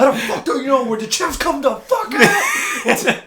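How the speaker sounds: high, loud, drawn-out mock outburst; exasperated shouting. Man losing it over the clogged wood chipper, exasperated shouting. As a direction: yell it slowly in exaggerated outrage.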